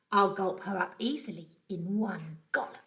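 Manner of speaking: a sly, hungry whisper to himself; hushed villain's whisper; greedy whisper. Acted. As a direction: whisper it like a plotting crocodile. Crocodile's greedy private thought.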